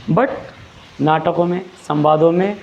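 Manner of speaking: ordinary talk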